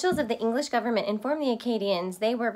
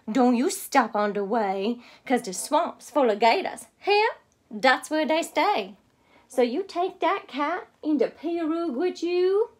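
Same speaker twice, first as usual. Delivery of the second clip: higher Cajun mama warning; Mama's voice; 4 semitones higher, 3 dB louder than usual. Acted. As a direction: warn the child like a worried Cajun mother. Mama warns of gators.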